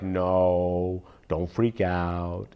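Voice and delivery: low, soft, slow hushing; calm the public down, softly and slowly; mock soothing government. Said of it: "the government was like".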